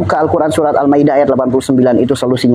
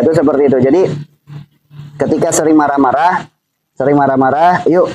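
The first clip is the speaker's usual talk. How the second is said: ordinary delivery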